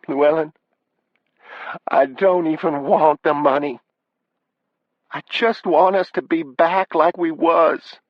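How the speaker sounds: high, upset wife pleading; high-pitched wife near tears. Acted.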